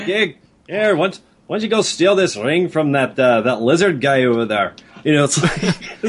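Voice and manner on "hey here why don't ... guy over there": cheeky Scottish rogue; mock Scottish accent. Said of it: "You can talk like this all night".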